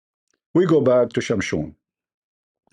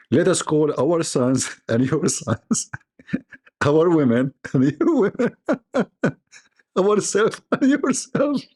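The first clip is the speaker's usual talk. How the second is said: laughing while reading; 5 semitones higher than usual. Real, not acted. Reading the verse through giggles.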